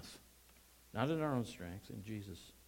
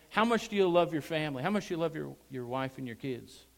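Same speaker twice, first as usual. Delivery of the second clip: normal talking voice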